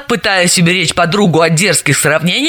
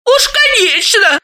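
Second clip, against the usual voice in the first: agreeing; slower than usual. A narrator's reading. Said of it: "согласилась Галина".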